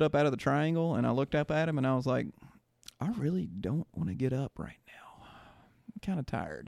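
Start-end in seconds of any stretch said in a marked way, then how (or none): 3.0-6.7 drained, breathy whisper trailing off; tired whisper. Retold or imitated. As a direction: sink into an exhausted whisper. Retelling thought during fight.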